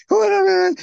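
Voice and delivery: high falsetto woman's voice, imitating; mock a woman's high voice. Sir John faking a woman's voice to fool the landlady. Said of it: "he talks like a woman".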